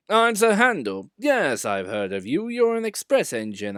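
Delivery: high, loud boast; boastful engine. A narrator's reading. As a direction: show off.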